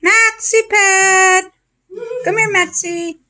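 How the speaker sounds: very high, slow cooing; sing-song coax the dog over. Real, not acted.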